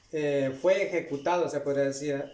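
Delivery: ordinary delivery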